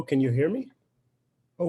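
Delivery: ordinary talk, nothing marked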